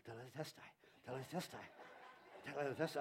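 low, soft repeated Greek word; muttering pastor. Retold or imitated. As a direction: pace and mutter it to yourself. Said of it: "he's been walking around in his office".

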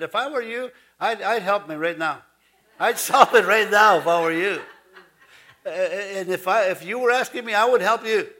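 hammed-up prayer telling God what to do; bargaining with God. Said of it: "and yet we say".

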